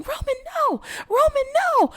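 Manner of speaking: acted scream. Evie screaming at unreachable Roman, shrill acted panic. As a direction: shriek it in panic, stretching the no. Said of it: "She's screaming".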